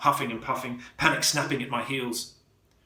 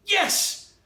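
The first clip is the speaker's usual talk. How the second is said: very high burst of triumph; triumphant; 3 dB louder than usual. A narrator's reading. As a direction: punch the air yes. Trap finally triggers.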